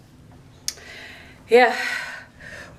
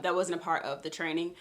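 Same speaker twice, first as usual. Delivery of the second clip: ordinary delivery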